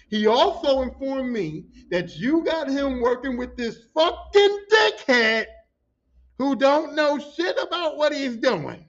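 slow, loud, high recital of the boss's words; emphatic quote. Retold or imitated. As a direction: spell out the insult slowly, punching each word. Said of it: "and I quote".

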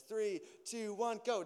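a hint of a coach calling go; coach's countdown. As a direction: start the kids off like a coach. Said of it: "He says".

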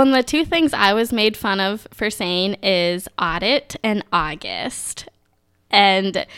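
usual speaking voice

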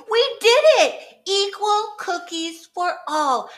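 high, loud, laughing cheer. Acted. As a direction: celebrate with joy. Problem solved, cheering.

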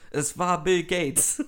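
slow triumphant mock reveal; mock revelation. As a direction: announce the big truth, hammed up. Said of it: "Jetzt kann ich die Wahrheit sagen".

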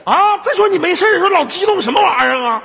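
high, loud tirade; mock-angry scolding. Host scolding caller for getting excited, mock-angry. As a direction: rant at them in comic exasperation.

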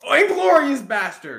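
long, high, loud shout of recall; excited shout. Real, not acted. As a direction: yell it out as it finally comes back. Suddenly remembers the title he forgot.

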